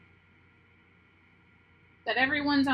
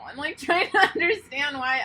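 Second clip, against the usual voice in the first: emotional laugh; 4 semitones higher, 7 dB louder than usual. Real, not acted. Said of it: "why do I feel emotional".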